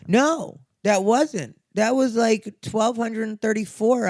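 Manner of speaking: high, loud heated insistence; emphatic pushback. Real, not acted. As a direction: push back hard on each word. Rebutting the email claim heatedly.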